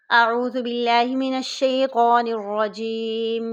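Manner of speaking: slow, melodic tajweed recitation; Quranic recitation. Acted. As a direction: recite it with measured reverence. Recites taawwuz before lesson.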